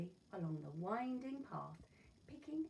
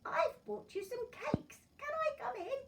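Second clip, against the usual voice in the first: put-on wolf faking a sweet high girl's voice; big bad wolf in a much higher put-on voice; 8 dB louder than usual. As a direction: squeak it up to pass as the granddaughter. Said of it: "said the wolf in a high voice".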